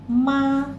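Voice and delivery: ma held level; first tone ma. Shown as a demonstration. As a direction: sing ma out flat and high. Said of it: "saya tambahkan nada di atasnya".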